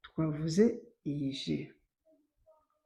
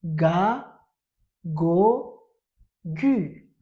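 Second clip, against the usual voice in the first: ga go gu called out louder; loud drilling; 5 dB louder, much slower than usual. Shown as a demonstration. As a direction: model the syllables once more. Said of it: "Répétons encore".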